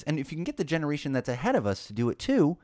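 normal talking voice

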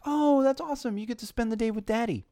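very high-pitched mock cooing; gushing older cashier. Checker congratulating a toddler on a day with Daddy, patronizingly delighted. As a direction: gush to a toddler as if it's a rare treat. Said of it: "like it's a special treat".

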